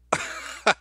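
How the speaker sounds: short burst of laughter; quick amused laugh. Real, not acted. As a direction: break into a brief real laugh. Laughs at racist accent request.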